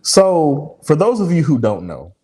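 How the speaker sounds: ordinary talk, nothing marked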